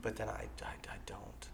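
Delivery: breathy whisper. Real, not acted. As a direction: drop to a whisper. Self-deprecating aside.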